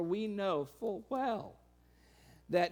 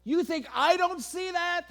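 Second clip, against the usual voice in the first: higher, much louder scoffing challenge; mock incredulous shout; 11 semitones higher, 9 dB louder than usual. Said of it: "Jesus saying".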